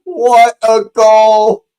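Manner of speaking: slow, loud, drawn-out praise; awed. Real, not acted. Streamer marvelling at the goal, slow awed exclaim. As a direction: savour every word of it. After the shout.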